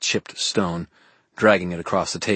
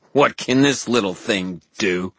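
contemptuous sneer; 2 semitones higher, 3 dB louder than usual. A narrator's reading. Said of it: "Aurora let out another laugh".

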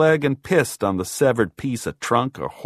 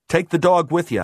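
higher, louder instruction; firm order; 7 semitones higher, 5 dB louder than usual. A narrator's reading. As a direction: call it after her as a command. Narrator calls order after her.

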